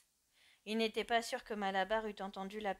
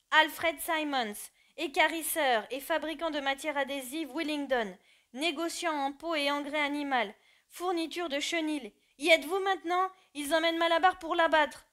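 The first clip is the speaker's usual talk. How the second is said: reading aloud loudly; 5 semitones higher, 8 dB louder than usual. Acted. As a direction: proclaim each word of the sign to the silent crowd. Benjamin reads the sign aloud.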